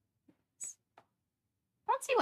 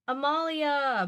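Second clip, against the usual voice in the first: higher, louder call up the stairs; calling upstairs; 8 semitones higher, 5 dB louder, much slower than usual. Acted. Mother calling her daughter upstairs, raised voice. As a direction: call the name up to another floor. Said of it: "Mommy calls upstairs".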